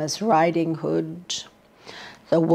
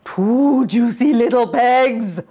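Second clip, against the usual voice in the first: wolf; 5 semitones higher, 7 dB louder than usual. A narrator's reading. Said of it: "Wolf cried".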